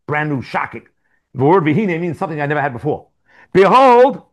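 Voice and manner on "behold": dramatic behold. Acted. Dramatic reading of the verse.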